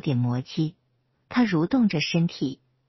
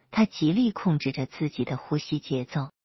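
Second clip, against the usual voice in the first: usual speaking voice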